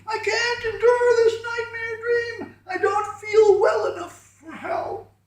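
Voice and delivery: very high, slow wailing scream. A narrator's reading. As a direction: shriek in despair. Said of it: "began again to scream".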